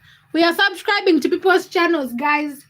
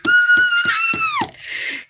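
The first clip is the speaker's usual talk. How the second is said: a very high, shrieking burst of laughter; delighted squealing laugh. Real, not acted. Host reacting to chat news, squealing with laughter. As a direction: let out a thrilled scream-laugh.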